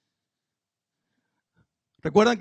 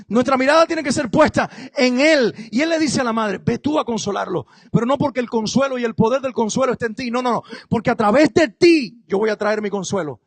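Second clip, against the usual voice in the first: full-force preaching, louder; predicando con fuerza; 4 semitones higher, 6 dB louder than usual. Acted. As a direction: proclaim God's care over the crowd. (predicación vehemente)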